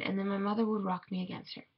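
ordinary delivery, nothing marked

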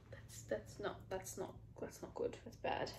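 whispered under her breath; dismayed whisper. Real, not acted. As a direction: breathe it out in horror at the mess. Dismay at uneven haircut.

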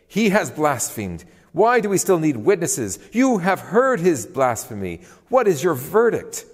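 high, loud, indignant outburst; outraged accusation. A narrator's reading. High priest accusing Jesus of blasphemy to the council, outraged.

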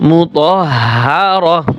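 মুতাহহারাহ very slowly, final h breathed; waqf stop. Shown as a demonstration. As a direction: recite মুতাহহারাহ slowly, stopping on "rah". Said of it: "ওয়াকফ করার সময়". (ধীরে, শেষে "রহ" দিয়ে মুতাহহারাহ)

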